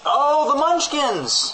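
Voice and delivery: high, slow delighted cry; drawn-out high squeal of delight; excited. Acted. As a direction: gush it like spotting something adorable. Excited misnaming exclamation.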